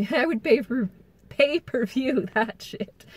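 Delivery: laughing through the words. Real, not acted. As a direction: chuckle as you say it. Laughing about the fight.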